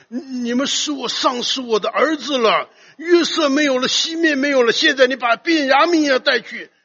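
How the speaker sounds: high, loud protest of a worried father; acted distress, pushed up and insistent; anxious father. Preacher voices Jacob protesting to his sons over lost children, anxiously. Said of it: "很紧张了".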